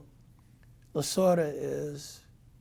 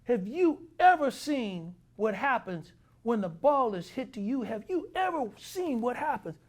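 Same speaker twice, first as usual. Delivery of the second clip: raised manager lecture; 8 semitones higher than usual. Retold or imitated. Manager lecturing Saxy on fielding, raised and high. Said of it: "He says".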